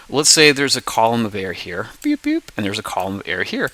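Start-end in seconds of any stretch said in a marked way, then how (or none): 2.0-2.5 two high little beeps; high-pitched sound effect; imitated beeps. Shown as a demonstration. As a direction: beep twice like placing markers. Marks imagined columns of air.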